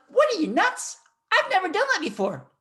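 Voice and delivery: high-pitched, alarmed disbelief; squeaky, mock-panicked objection; incredulous protest. Acting out his panicked reply to coach.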